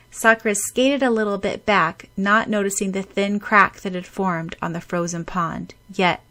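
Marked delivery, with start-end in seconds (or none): none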